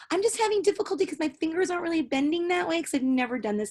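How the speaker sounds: high, prissy imitation of the dancer; prim dancer impression. Imitating the pious dancer's excuse, high and prim.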